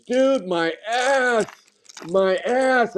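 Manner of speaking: high, slow mock yell of a sledding kid; dude yelling. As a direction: ham up the kid's yelp. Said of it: "he goes".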